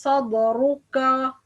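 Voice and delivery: slow sadruka with a dark, heavy final ka; wrong heavy kaf. Shown as a demonstration. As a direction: back the last vowel of sadruka. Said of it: "Wrong, which we pronounce".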